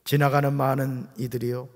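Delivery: ordinary talk, nothing marked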